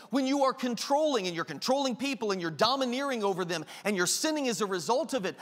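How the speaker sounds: ordinary talk, nothing marked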